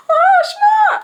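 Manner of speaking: very high, loud mock wail; imitated panicked sister. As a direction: wail it like a flustered little sister.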